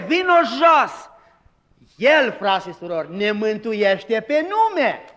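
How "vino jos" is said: calling out. Pretend. Jesus calling Zacchaeus down.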